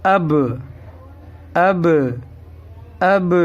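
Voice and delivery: اب sounded out as short "ab" with released b; separate, demonstrated اب, "ab-uh", with pauses between; two letters joined. Shown as a demonstration. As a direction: join short a to b in each اب. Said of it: "کس طرح ملائیں گے".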